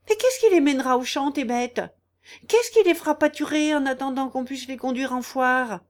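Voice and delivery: high, loud and vexed; indignant complaint. Acted. Aunt demanding who will tend the animals, vexed. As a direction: throw the question back angrily. Aunt quarrels with her niece.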